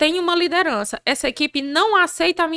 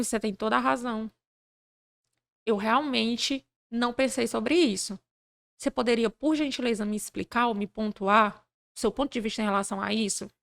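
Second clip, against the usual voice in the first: soft, lower, measured politeness; calm, courteous concession; 3 semitones lower, 8 dB quieter than usual. Retold or imitated. Leader conceding to a worker spoiling for conflict, calmly polite. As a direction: disarm with quiet, deliberate courtesy. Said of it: "ele diz assim".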